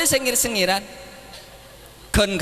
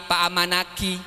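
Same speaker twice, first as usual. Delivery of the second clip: ordinary talk, nothing marked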